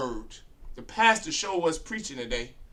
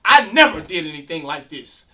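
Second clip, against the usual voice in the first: imitating a politician's loud, insistent denial; mock adamant denial; 8 dB louder than usual. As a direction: deny it like a caught politician, louder. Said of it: "are so adamant about how they speak".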